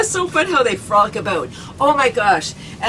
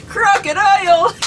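high shouted laughing; crocodile laughter; 11 semitones higher than usual. Pretend. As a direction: laugh wildly like a snapping crocodile. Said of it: "crocodile laughter".

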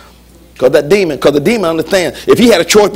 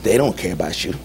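soft, much lower tempter's voice; low insinuating murmur; 10 semitones lower, 7 dB quieter than usual. Acted. Devil turning kids against their parents, low hushed insinuation. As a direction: imitate a hushed, planting-doubt undertone. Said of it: "He whispered to you".

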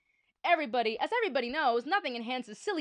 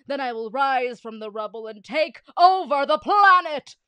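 loud gloating villain voice; 4 semitones higher, 15 dB louder than usual. Acted. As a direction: declare it like a triumphant villain.

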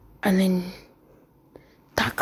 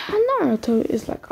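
ordinary talk

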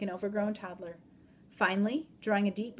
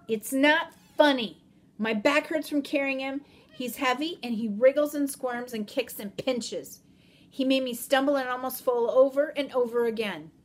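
angry complaint; 5 semitones higher, 8 dB louder than usual. Acted. Molly complaining angrily to Mad Dog.

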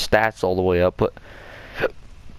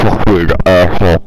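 angry shout; 2 semitones lower, 12 dB louder than usual. Real, not acted. Angry at the game.

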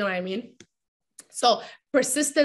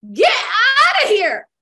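higher, louder, slow get-away yell; mock shout; 8 semitones higher, 7 dB louder, much slower than usual. Around a negative person, slow mock shout to leave.

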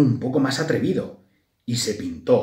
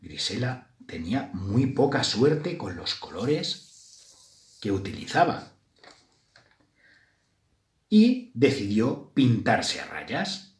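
ordinary delivery

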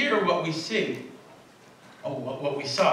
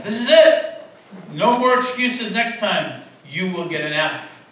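higher, louder stern professor warning; 4 semitones higher, 5 dB louder than usual. Retold or imitated. Professor warning late students, higher louder sternness. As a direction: lay down the law to the class. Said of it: "but warned them".

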